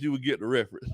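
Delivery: ordinary talk, nothing marked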